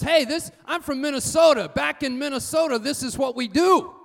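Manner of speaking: high, loud protest, hands up; indignant shout. Retold or imitated. Retold colleague shouting his defence in the car. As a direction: bluster back that this is normal at home.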